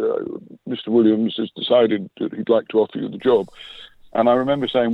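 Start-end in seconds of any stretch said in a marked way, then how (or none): 0.0-3.5 lightly imitated posh English accent, polite and formal; a hint of a posh English colleague delivering good news. On the phone, imitating the posh-accented commercial man offering the job. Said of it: "in his very posh voice".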